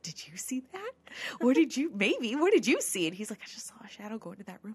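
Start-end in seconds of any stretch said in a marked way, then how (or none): 0.0-3.1 higher, urgent retold reply; excited questioning. Friend answers a whispered question on the tour, excited. 3.4-4.7 quick, soft confiding; imitated hushed reply. Friend reports a shadow during the tour, hushed and quick.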